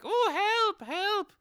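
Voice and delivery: put-on very high, loud child's cry for help; little boy's cry. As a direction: cry out like a frightened small boy. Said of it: "like a little boy sound".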